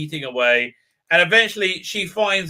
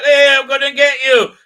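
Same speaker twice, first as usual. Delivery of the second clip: mock boast; 9 semitones higher, 9 dB louder than usual. Mocking the crew member's boast.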